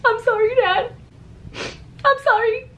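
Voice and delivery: very high, squeaky tearful imitation; hammed-up sobby little voice; mock crying apology. Daughter apologizing to laughing dad, mock crying apology. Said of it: "I was crying".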